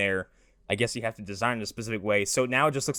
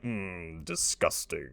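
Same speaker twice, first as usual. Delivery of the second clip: low, hushed monster disdain; 6 semitones lower, 7 dB quieter, much slower than usual. Acted. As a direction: rumble it quietly like a disgusted beast. Voicing the monster Goro's reply.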